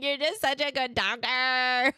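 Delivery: slow, high-pitched blubbering imitation; mock bawling. Sobbing mother praising her son's doctor, mock bawling. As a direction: wail it slowly, making fun of yourself. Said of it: "I started bawling".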